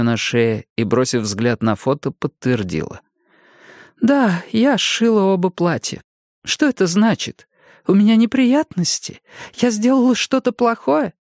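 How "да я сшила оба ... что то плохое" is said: высокий тревожный старческий голос; испуганная пожилая женщина; встревоженная старушка. A narrator's reading. Old Jenny worried. (голос встревоженной старушки)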